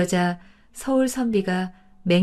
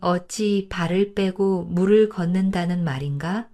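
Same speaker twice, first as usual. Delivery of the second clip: usual speaking voice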